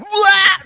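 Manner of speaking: high burst of laughter; laughing at his own joke; loud laugh. Real, not acted. Laughing after mock yell.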